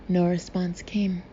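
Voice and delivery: ordinary delivery, nothing marked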